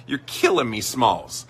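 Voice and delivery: normal talking voice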